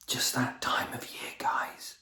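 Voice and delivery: whispered sarcastic aside. Real, not acted. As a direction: whisper it knowingly.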